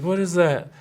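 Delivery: high-pitched worried question; mock-worried kid's question; imitated worry. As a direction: imitate a son getting anxious. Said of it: "But he starts worrying".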